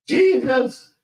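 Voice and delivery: ordinary talk, nothing marked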